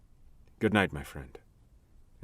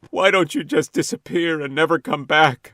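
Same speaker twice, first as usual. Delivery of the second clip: angry outburst; 7 semitones higher, 7 dB louder than usual. Acted. Man confronts ghost girl.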